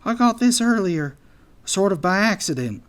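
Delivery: high-pitched, admitting it awkwardly; sheepish anteater. A narrator's reading. Anteater shyly explains his envelope.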